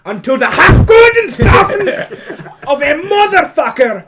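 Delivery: shouting German dictator rant; Hitler ranting. Retold or imitated. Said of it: "It's like he's really here".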